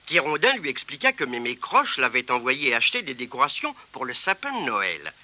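plain reading voice

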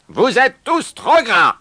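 high, loud outburst of disgust; angry scolding. A narrator's reading. New owner berates the horses, angry scolding. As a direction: berate them at full voice.

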